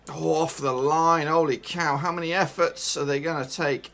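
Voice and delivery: loud exasperated outburst; loud disbelieving roar; excited shout. Real, not acted. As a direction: yell as the ball is cleared off the line. Goal-line clearance, exasperated.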